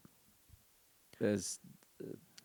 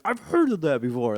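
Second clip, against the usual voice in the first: higher, louder imitation of a boy; mock kid voice; 5 semitones higher, 5 dB louder than usual. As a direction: play your son recognizing something. Imitates son recognizing a term.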